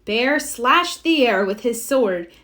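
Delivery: ordinary delivery, nothing marked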